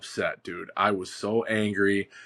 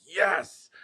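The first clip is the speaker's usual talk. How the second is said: excited yes; 9 semitones higher than usual. Retold or imitated. Call starts ringing.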